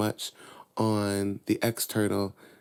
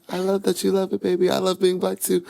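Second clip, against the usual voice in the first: much higher, tender mom voice; loving mom; 9 semitones higher, faster than usual. Retold or imitated. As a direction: reassure her little girl sweetly. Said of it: "And she was like".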